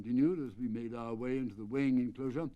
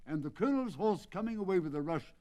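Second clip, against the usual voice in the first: ordinary delivery, nothing marked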